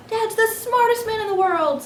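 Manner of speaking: put-on excited child. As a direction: burst out with joy at dad's win. Kids cheer after success.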